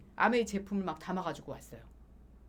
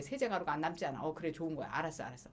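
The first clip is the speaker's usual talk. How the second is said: dismissive; 5 semitones lower, 5 dB quieter, faster than usual. Retold or imitated. Brushing off sister's sales pitch.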